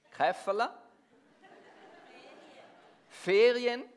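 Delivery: ordinary talk, nothing marked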